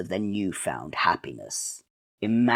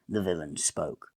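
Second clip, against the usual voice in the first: ordinary delivery, nothing marked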